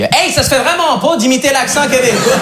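high, slow drawled Québécois friend; best friend scolding in a thick Québécois accent. Retold or imitated. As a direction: stretch it out in a broad Québécois accent. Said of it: "il me l'a pas dit comme ça".

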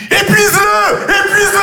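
high shouted cheer; mother shouting encouragement from behind; mother yelling. Retold or imitated. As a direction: yell it like a mom urging dad on. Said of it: "qui crie".

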